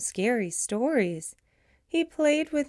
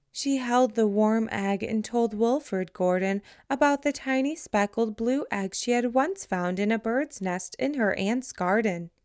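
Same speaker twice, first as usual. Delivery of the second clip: plain reading voice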